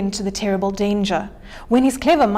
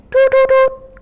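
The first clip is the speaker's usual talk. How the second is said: high, loud hoot; owl hooting call; 8 dB louder than usual. Acted. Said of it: "called the owl".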